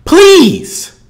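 high, loud shouted begging; exasperated plea. Real, not acted. Host begging viewers for funny clips, exasperated shout. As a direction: yell it out, stretching the pleas.

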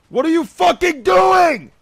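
loud, high, incredulous yell; exasperated shout. Real, not acted. Player asking what someone is doing, exasperated shout. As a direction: yell it in outrage. Furious at another player's move.

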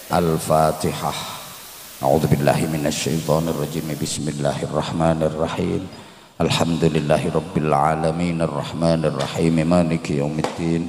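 low, steady Arabic prayer chant; prayer recitation. Real, not acted. Preacher closes the gathering with Arabic prayer, low recitation. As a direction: lead the congregation in supplication. Leads Al-Fatihah and closing prayer.

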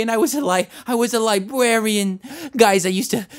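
ordinary delivery

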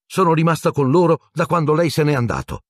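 high, indignant insistence; offended self-defence, pitched well up; incensed. A narrator's reading. Matron insisting she stayed with them, incensed. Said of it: "esclamò Madame Pomfrey incollerita".

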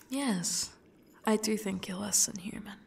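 ordinary talk

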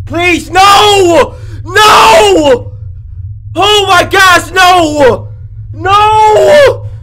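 real frightened pleading, high and loud; begging the game to stop, screaming; panicked shouting. Real, not acted. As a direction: yell no in terror. Monster chasing him in game.

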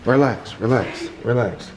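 soothing a scared dog. Real, not acted. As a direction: ease them down gently. Calming frightened pets.

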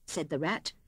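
usual speaking voice

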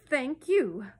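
loud, pointed thanks, high then falling; heavily meaning reminder of manners; meaningful. A narrator's reading. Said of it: "said Mary Poppins meaningly".

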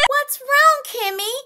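put-on high, concerned child voice; worried little girl. A narrator's reading. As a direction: ask like a caring small girl. Child asking upset friend.

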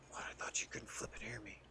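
souffle sans voix, à peine audible; whisper. Pretend. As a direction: chuchote pour que seul l'autre entende.

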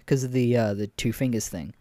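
ordinary delivery

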